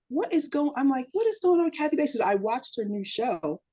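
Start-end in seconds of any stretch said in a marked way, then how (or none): 0.1-2.2 higher, quick incredulous question; stunned disbelief. Retold or imitated. Mom reading the Kathy Bates article, baffled. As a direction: react in disbelief to the news.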